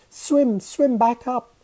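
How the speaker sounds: high, loud, urgent scream; squeaky high alarm; screamed plea. A narrator's reading. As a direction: cry out in panic to someone drifting away. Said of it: "Mahmud screamed".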